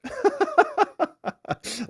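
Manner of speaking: a burst of high laughter at the letter; high amused laugh. Real, not acted. Laughing at a lawyer's showy letter, high and amused.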